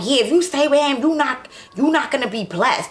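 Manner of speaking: high-pitched preachy imitation of his mother; retold mother telling her she won't be blessed; imitating the mother. Mother repeating her warning.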